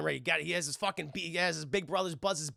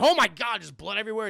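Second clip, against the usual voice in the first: loud, higher cry of alarm; mock horrified yell; 4 semitones higher, 7 dB louder, faster than usual. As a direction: yell out in acted horror. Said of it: "he's just screaming his fucking head out".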